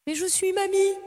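ghost voice. Acted. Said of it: "la voix de fantôme".

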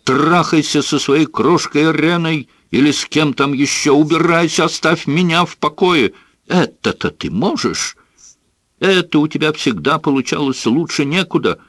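high, strained yelling; shouting. A narrator's reading. Son screams at father to leave. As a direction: hurl it at him high. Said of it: "закричал он".

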